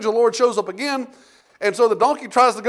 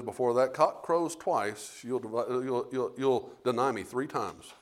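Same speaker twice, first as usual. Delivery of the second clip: lower, softer, slow solemn prophecy; Jesus foretelling; 9 semitones lower, 10 dB quieter, slower than usual. Retold or imitated. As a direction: say it gravely and slowly to Peter. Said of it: "He says".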